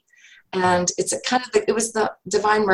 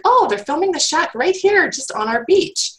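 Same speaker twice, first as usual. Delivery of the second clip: excited surprise; 9 semitones higher than usual. Retold or imitated. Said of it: "And we're like".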